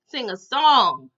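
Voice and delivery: incredulous echo. Retold or imitated. Storyteller echoing the mom's order, slowly incredulous. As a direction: draw out each word in disbelief.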